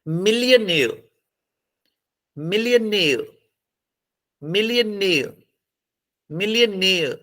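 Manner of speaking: Millionaire rising to a held, falling near; stress on last syllable. Shown as a demonstration. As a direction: lean on near at the end of millionaire. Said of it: "तो last किस पे stress लगेगी?".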